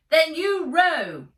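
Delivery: very high, loud, slow command; shout. Acted. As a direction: bark it down over the wind. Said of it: "shouted Ivy".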